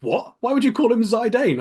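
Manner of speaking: high-pitched baffled protest; mock-outraged questioning; incredulous reaction. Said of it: "I'm like".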